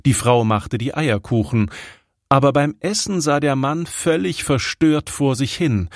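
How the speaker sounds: plain reading voice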